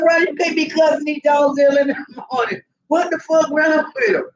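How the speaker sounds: high-pitched, mock-outraged telling-off; indignant scolding. Woman scolding Ronnie for early cussing, high mock indignation.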